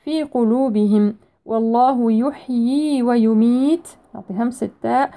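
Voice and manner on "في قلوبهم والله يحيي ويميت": measured tajweed recitation; Quran recitation. Shown as a demonstration. Teacher reciting a verse for students, measured tajweed. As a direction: recite with held long vowels and precise consonants.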